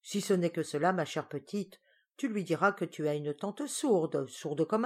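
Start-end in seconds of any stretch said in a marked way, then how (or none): none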